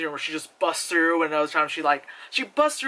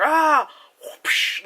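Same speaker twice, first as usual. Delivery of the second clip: whispered whoosh; 9 semitones higher, 4 dB louder than usual. Retold or imitated. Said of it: "like that".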